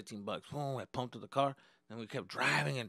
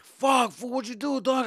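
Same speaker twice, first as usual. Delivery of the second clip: high, loud shout at the driver; retold yell; 11 semitones higher, 9 dB louder, slower than usual. To the friend who crashed, a high loud shout.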